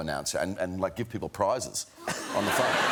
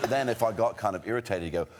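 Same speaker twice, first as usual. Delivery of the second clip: ordinary talk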